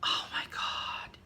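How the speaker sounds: slow, breath-only whisper of wonder; awed whisper. Real, not acted. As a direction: whisper it slowly, in awe. Awestruck at the card's colours.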